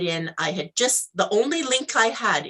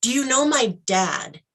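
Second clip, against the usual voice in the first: usual speaking voice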